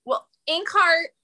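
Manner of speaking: usual speaking voice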